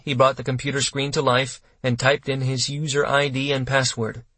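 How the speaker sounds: ordinary delivery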